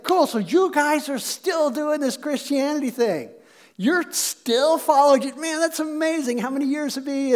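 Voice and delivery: playful, impressed disbelief; acted amazement. Peter amazed at modern Christians.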